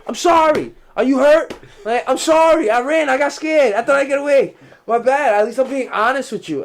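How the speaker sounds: plead, scared and overexcited; frantic pleading. Retold or imitated. Offering fifty bucks to the angry driver, loud high panic. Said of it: "I said".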